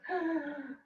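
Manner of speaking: quick frightened gasp; scared gasp. Shown as a demonstration. Teacher showing a scared inhale to cool the body. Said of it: "like scared".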